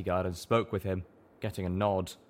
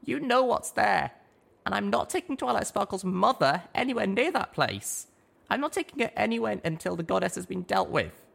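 high, determined little mare; a small pony putting her hoof down; high little pony voice, firm; young mare; 12 semitones higher than usual. A narrator's reading.

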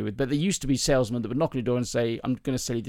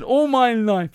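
high, loud impeded speech; comic man who sounds deaf; speech impediment; 10 semitones higher, 6 dB louder, slower than usual. Acted. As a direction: muddle the consonants, pitched way up. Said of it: "the guy sounds deaf".

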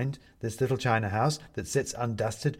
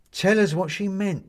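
eager; 9 semitones higher, 6 dB louder than usual. A narrator's reading.

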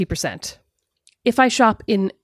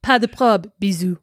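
mock French. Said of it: "obviously I meant".

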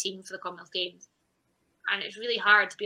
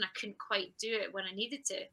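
ordinary talk, nothing marked

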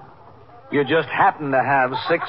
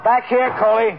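raised, higher-pitched call; 9 semitones higher, 4 dB louder, slower than usual. Acted. Captain summoning his officer into the bar, calling out. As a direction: call your officer over from across the room.

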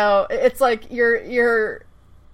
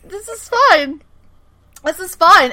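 mock breezy reassurance; 8 semitones higher, 4 dB louder than usual. Voices oblivious bystander's thoughts.

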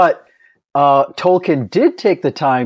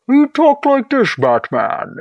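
Bane voice; 8 semitones higher than usual. Retold or imitated. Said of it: "he'd still talk like this".

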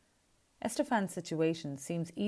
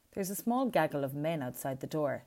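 usual speaking voice

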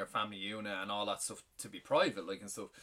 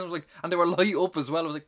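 ordinary delivery

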